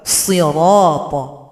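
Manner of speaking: sirata slow with a thick dark s; heavy shad. Shown as a demonstration. Teacher repeats sirata with heavy shad. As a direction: round and darken sirata. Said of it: "hurufnya tebal".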